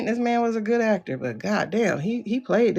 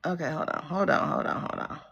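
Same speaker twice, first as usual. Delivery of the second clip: fading mutter; 6 semitones lower, 9 dB quieter than usual. Real, not acted. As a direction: hold on trailing away softer. Fading to herself.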